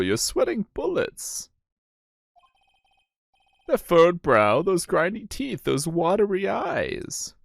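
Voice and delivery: high-pitched, swooping judge voice; high character voice, drawing out the ends; concerned courtroom judge. Acted. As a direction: question the prosecutor in a high, put-on voice. Voices the judge addressing the prosecutor.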